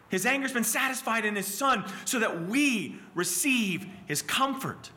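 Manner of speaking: high, loud, impassioned sermon delivery; full-force preaching. Acted. Preacher voicing a worried girl's questions, then answering from Isaiah, full-force preaching. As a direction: cry out the questions and answer them emphatically.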